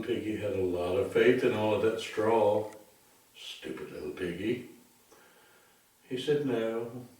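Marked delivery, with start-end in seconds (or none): none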